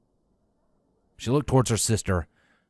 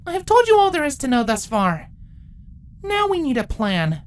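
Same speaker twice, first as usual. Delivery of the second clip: high firm, louder; commanding princess; 4 dB louder than usual. Acted. Twilight addressing the council queens, high firm voice. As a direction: take charge of the council.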